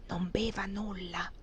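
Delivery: slow, barely audible whisper; mouthed warning. A narrator's reading. Maid repeats her secret warning to her mistress, slow mouthed whisper. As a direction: whisper it slowly and distinctly. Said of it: "rifece con le labbra la stessa".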